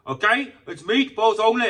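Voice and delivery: high, loud sneering imitation of a rude counter guy; mocking the shop worker. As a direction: mimic the shop worker snapping back, hammed-up. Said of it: "he started talking to me like".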